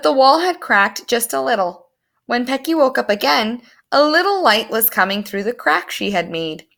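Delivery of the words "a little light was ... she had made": plain reading voice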